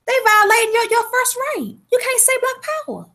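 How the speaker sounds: high, indignant protest; retold outrage. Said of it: "I said".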